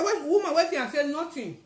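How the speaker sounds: high, loud startled-sounding outburst; retold shout. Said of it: "I shout".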